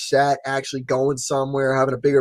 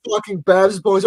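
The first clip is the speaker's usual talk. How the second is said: ordinary delivery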